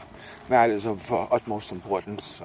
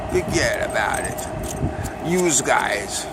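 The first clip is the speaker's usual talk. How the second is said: drawn-out, louder Brooklyn "fuhgeddaboudit, youse guys"; slow Brooklyn accent; 4 semitones higher, 5 dB louder, much slower than usual. Shown as a demonstration. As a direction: lay the Brooklyn on thick, slowly.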